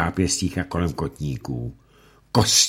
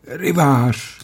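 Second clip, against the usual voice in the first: high, moaning wail of one word; mother wailing the name under torture; 10 semitones higher, 5 dB louder than usual. Acted. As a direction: moan it out in pain. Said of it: "úpila Setkin".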